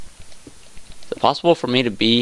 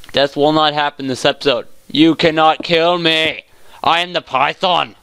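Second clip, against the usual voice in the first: grand proclamation, building higher; mock-heroic defiance; 3 semitones higher than usual. Gamer taunting death in the cave, mock-heroic defiance. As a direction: proclaim it like a game hero, slowing midway.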